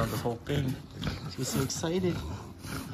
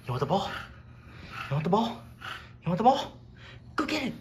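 building dog-ball excitement; 8 semitones higher, faster than usual. Real, not acted. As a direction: hype the dog up until it runs. Teasing dog with ball, rising excitement.

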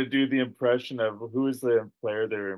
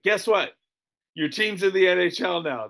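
animated, raised-pitch mock announcement; excited announcement; 7 semitones higher than usual. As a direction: break the big news, higher pitched. Acting out announcing promotion news.

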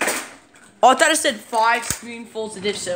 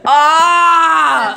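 disgusted yell; 6 semitones higher, 8 dB louder than usual. Real, not acted. Reacts to the hot sauce milk.

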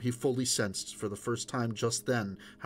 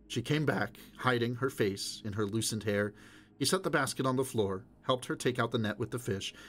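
ordinary delivery, nothing marked

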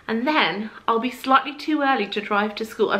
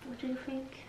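ordinary delivery

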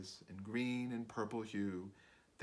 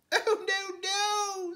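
very high, loud little fly protest; 7 dB louder, slower than usual. Acted. Fly refusing the spider's bed, high frightened squeak. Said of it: "said the little fly".

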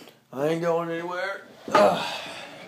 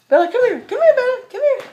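high, loud call to the dog; calling Bella across the room, high and loud; 8 dB louder than usual. Real, not acted. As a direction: sing out her name to bring her over.

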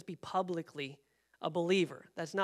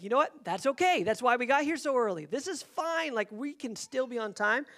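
raised pitch, insistently optimistic; upbeat self-reassurance; 5 semitones higher, 4 dB louder than usual. Retold or imitated. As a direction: talk yourself into staying positive. Retold self-reassurance, early setback.

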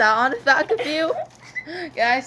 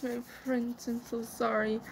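very soft, sorry play voice; softly play-acted regret; hushed pretend apology; 12 dB quieter than usual. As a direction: drop right down to a gentle apology.

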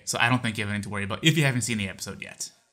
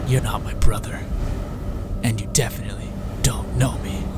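slow threatening whisper; bad acting. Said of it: "excuse my terrible acting".

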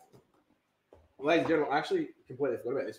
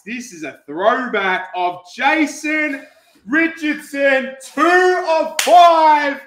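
loud, high, slow stretched calls; building to a shouted peak, then easing; hype announcer; 9 semitones higher, 15 dB louder, much slower than usual. Acted. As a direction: boom out the rare card like an arena MC.

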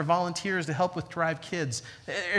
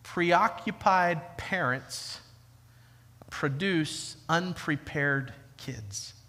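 slower, softer repeat; 2 semitones lower, 3 dB quieter, much slower than usual. Shown as a demonstration. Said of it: "Can, can I say it again?".

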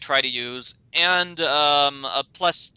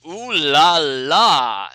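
loud, drawn-out swoon of appreciation; big slow hammed-up ogle; admiring ooh-la-la; 6 dB louder, much slower than usual. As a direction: stretch it out, leering at the bikini. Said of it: "takes one look at that tiger striped bikini and goes".

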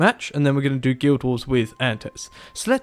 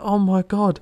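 more exasperated, higher; 7 semitones higher than usual. Retold or imitated. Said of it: "And I'm just like".

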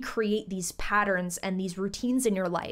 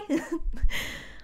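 breathy laugh; 7 semitones higher than usual. Real, not acted. Laughing after the sign-off.

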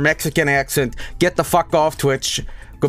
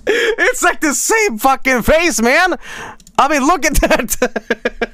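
higher, laughing through the words; 11 semitones higher, 3 dB louder, slower than usual. Real, not acted. Streamer still laughing at the same face, slowly. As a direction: talk while still cracking up.

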